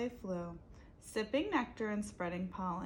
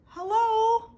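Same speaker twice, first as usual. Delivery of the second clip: high loud called hello; calling out; 13 semitones higher, 13 dB louder than usual. Acted. Abel calling back toward the pile, loud. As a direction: call out for her. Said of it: "Abel called".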